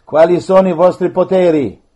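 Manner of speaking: loud, demanding questions; stern command. Real, not acted. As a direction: interrogate the spirits. Priest commanding the spirits.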